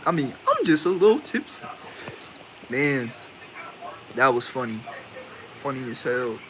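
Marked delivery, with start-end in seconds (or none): 0.5-1.6 tipsy woman voice. Retold or imitated. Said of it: "the way she said it".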